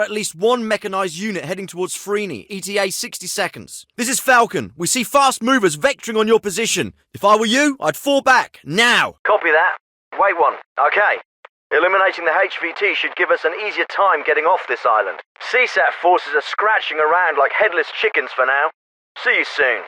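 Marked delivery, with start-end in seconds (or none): 4.0-9.1 high, forceful urgent radio warning; pressing alarm, pitched up and emphatic. Acted. Radio warning of incoming enemy fire. 9.2-19.8 military officer over a field radio; crisp orders through a narrow radio channel; radio transmission. Acted.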